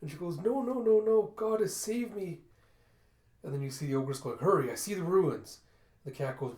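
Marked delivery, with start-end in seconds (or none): 0.4-2.4 high, loud frightened cry; panicked denial. A narrator's reading. Kippa panics at vision. 4.4-5.6 raised, loud urging; urgent call. A narrator's reading. Ogress urges flight.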